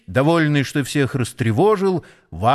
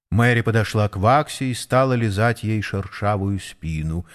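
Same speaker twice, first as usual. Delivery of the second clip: usual speaking voice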